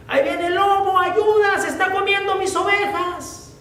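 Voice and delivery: the wolf is really here, call out in panic; frightened cry for help. Acted. Shepherd boy begging the villagers for help, panicked high cry. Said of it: "empezó a gritar".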